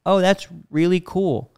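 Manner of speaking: slow, hollow politeness; fake interest. Husband feigns interest in wife's recipe, slow and hollow. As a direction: pretend to care, slowly.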